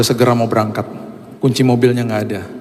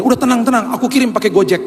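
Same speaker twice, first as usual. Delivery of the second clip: lightly higher, breezy wife voice, no worries; wife reassuring; 10 semitones higher than usual. Retold or imitated. Wife on the phone soothing her panicking husband, breezy and higher. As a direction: brush it off like a calm wife. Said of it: "Terus dia bilang begini".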